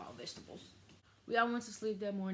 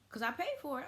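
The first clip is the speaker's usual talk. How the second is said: retold indignation; 8 semitones higher, 4 dB louder than usual. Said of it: "I was mad than a bitch".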